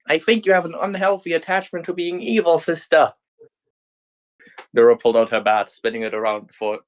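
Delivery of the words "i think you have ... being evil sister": put-on raised, deadpan young heroine; dry heroine retort. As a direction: tease your evil twin drily.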